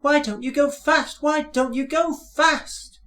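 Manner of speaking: very high, anxious coach voices; high, worried calling; anxious coaches. A narrator's reading. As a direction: fret like a worried little coach. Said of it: "called Annie and Clarabel anxiously".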